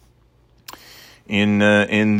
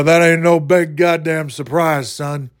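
higher-pitched bad wrestler impression; terrible Stone Cold; 6 semitones higher than usual. Fan does his terrible wrestler impression, hammed-up. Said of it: "That's my terrible Stone Cold".

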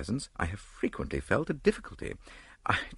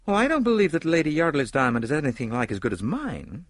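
higher-pitched, boastful film star; higher, confident character voice; self-assured film star; 7 semitones higher, 4 dB louder than usual. A narrator's reading. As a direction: voice a proud actress dismissing a rival. Story figure, film star boasting.

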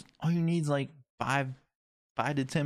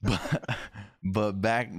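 normal talking voice

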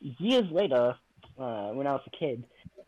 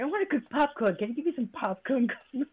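put-on higher, pleading little kid; eager child voice; 9 semitones higher than usual. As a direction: ask it like an eager small child. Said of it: "Yeah, I was like".

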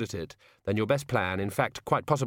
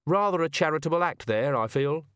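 higher, lightly voiced politeness; self-satisfied villain; 5 semitones higher than usual. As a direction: remark courteously on your own deed. Killer comments as victim vanishes.

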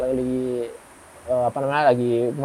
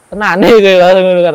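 louder, sudden alarmed exclamation; startled outburst; 3 semitones higher, 11 dB louder, faster than usual. Retold or imitated. Teller reacting to the blood ritual, loud startled outburst. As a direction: blurt it out in shock.

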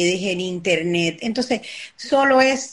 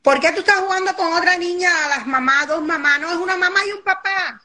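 high, fast disapproving scold; disapproving parent; 7 semitones higher, faster than usual. Retold or imitated. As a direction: rush out a shocked parent's correction.

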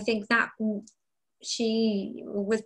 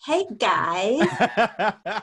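louder put-on American greeting; mock American accent; 5 dB louder than usual. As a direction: let a jokey inner American burst out. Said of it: "Inner American trying to come out".

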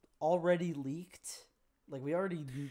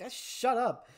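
higher-pitched exasperated aside; mock annoyed; 7 semitones higher, slower than usual. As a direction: play at telling noisy moviegoers off. Imagined annoyance at cheering moviegoers.

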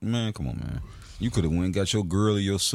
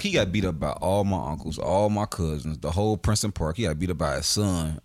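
ordinary talk, nothing marked

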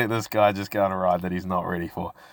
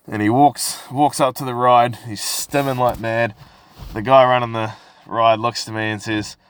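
ordinary delivery

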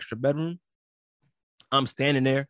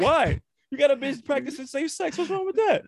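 mock outrage; 12 semitones higher than usual. Real, not acted. To the cohost who said yuck, laughing mock-indignant and high. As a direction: defend her, half laughing and worked up.

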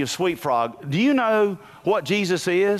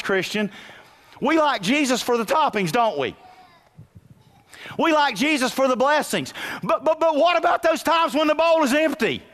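full-force preaching; 9 semitones higher than usual. Acted. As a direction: drive the point home to the church.

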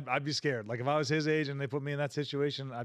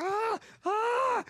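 scared yelp. Retold or imitated. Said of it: "The kid's like".

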